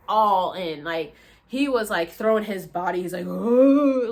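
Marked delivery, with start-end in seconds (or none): 3.3-4.1 loud guttural heave; retching groan. Retold or imitated. Imitating the guy behind her, loud retching groan. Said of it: "it was just coming out".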